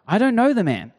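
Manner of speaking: high, insistent protest of "I don't know the man"; panicked denial. Retold or imitated. Peter denies knowing Jesus to the servants, high panicked protest. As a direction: brush the accusation off fast and high. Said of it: "he said".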